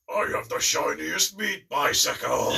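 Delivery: loud, slow psycho yell; shouting Krieg the Psycho. Acted. Contestant imitating a game psycho's shouting, loud deranged yell. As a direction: bellow it like a raving psycho. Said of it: "he keeps shouting stuff like".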